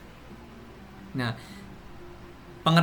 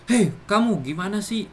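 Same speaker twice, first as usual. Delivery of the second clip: loud, higher impatient scolding; scolding demo; 3 semitones higher, 4 dB louder than usual. Shown as a demonstration. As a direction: snap at someone who can't do a simple thing. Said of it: "maka intonasi yang digunakan adalah intonasi tinggi".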